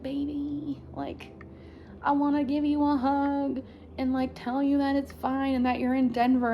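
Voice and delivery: like comforting a sick friend; tender comforting. Retold or imitated. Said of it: "I'm also just like".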